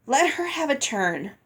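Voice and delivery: strained, teeth clenched; through clenched teeth. A narrator's reading. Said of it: "through clenched teeth".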